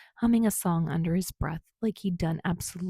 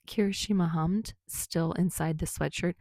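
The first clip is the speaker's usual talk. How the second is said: plain reading voice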